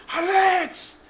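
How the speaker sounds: high-pitched yelled name call; long, high call-out; retold yell. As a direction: yell for your brother from another room. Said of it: "le empecé a gritarle".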